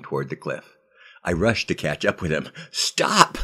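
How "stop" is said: sharp high breathy shout of stop; panicked call to stop; urgent cry. Acted. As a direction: yell to halt him. Grabbing friend heading for cliff.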